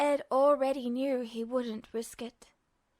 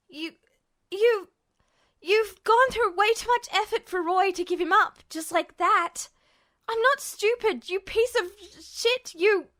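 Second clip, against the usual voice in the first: high, loud stammering fury; desperate shout; 6 semitones higher, 4 dB louder than usual. A narrator's reading. Captive Ed yelling at Justin in desperate anger. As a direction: fight back with everything left.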